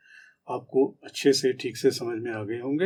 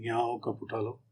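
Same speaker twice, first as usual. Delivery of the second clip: soft, tender request, much softer; gentle to wife; 3 semitones lower, 10 dB quieter than usual. Shown as a demonstration. Husband gently asking his wife to pick up the cup. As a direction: ask gently, not in anger. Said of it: "Mrs है आपकी, तो आप गुस्से में तो नहीं कहेंगे".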